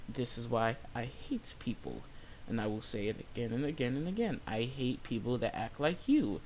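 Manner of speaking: low, quiet, measured comeback; deliberate retort. Retold or imitated. Cashier standing by the insult to customer, deliberate. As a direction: repeat it to her face, soft and low.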